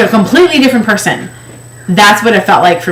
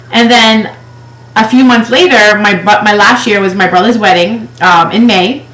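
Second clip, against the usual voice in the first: usual speaking voice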